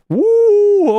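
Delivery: a high, loud whoop of excitement; excited woo. Real, not acted. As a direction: cheer out loud to kick off the stream. Hyped cheer opening Friday stream.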